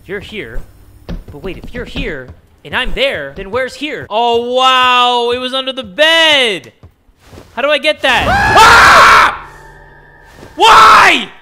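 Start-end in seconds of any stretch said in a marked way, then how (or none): 4.1-6.7 high, loud, stretched-out exclamation; big astonished holler; loud amazement. Real, not acted. Loud reaction to finding it. 8.3-9.5 loud, high scream of fright; piercing-high yell; startled scream. Real, not acted. Jump scare. 10.5-11.3 loud, high, pleading shouts; exasperated full-voice outburst; distraught yelling. Real, not acted. Yelling after a jump scare.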